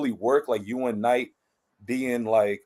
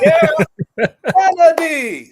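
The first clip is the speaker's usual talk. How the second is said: "Yeah, Kennedy" much higher and louder, laughing; laughing showcase; 7 dB louder, much slower than usual. Shown as a demonstration. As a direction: belt out the name with a laugh. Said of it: "you clap it out".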